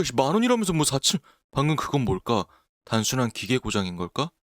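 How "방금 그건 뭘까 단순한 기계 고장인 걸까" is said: usual speaking voice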